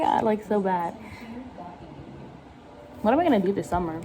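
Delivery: ordinary delivery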